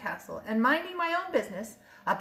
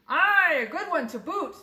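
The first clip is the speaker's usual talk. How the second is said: loud, higher pirate crew chorus; crew agreeing; 6 semitones higher, 5 dB louder than usual. A narrator's reading. Pirate crew agreeing with their captain, rowdy chorus. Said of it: "the others agreed".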